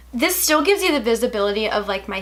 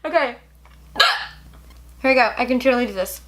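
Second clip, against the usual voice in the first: talking through hiccups; speaking between hiccups; faster than usual. Real, not acted. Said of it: "Stop the hiccups".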